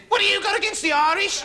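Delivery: high, loud mock conductor challenge; a touch of an indignant bus conductor. Bus conductor challenging the passenger, mock indignant and loud.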